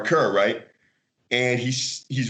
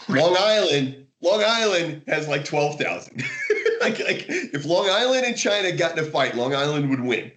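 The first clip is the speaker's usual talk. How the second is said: laughing; 7 semitones higher than usual. Real, not acted. Laughing at own joke.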